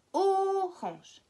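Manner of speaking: orange with a long, high, held O; stressed opening O. Shown as a demonstration. Teacher showing O at the start, first vowel stretched. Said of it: "au début".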